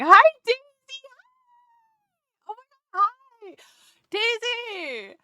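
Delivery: high, squealed hello; excited greeting. Real, not acted. Greeting a friend who drops into the stream, excited squeal. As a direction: gush in a high-pitched voice.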